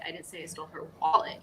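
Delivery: fast run-up, "wallet" punched with a rise; stress on WALLET. Shown as a demonstration. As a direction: land the weight on "wallet". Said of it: "depending on which word you put the emphasis on".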